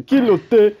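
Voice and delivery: shouted high and loud, pretend African; mock shout. Mocking the old man, a pretend shout at him. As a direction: bark it out, pretending to slate him.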